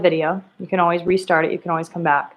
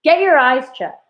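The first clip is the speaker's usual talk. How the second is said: higher pitched fed-up yell at the TV; exclaimed with annoyance; annoyed exclamation; 6 semitones higher, 4 dB louder than usual. Shown as a demonstration. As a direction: snap it like a frustrated fan. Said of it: "why does the author do that".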